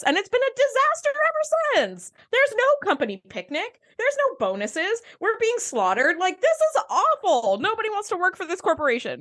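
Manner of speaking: high-pitched, exasperated griping; mock complaining rant. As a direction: rant like a fed-up employee, playfully overdone. Mock employee rant voiced for Hugh.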